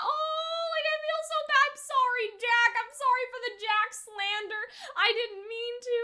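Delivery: high, crying apology to Jack; tearful apology. Retold or imitated. Apologizing to Jack for the slander, high teary plea. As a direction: sob out the sorry like you mean it. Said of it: "and I'm like".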